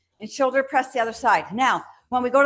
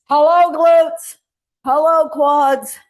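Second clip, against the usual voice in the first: playful greeting; 3 semitones higher, 8 dB louder, slower than usual. Real, not acted. Playfully greets the working muscles.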